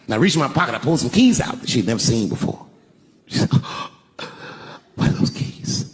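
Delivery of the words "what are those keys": slow, unvoiced mock sob; imitated tearful gasp. Crying wife asking her husband about keys, imitated tearful whisper. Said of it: "she was just crying".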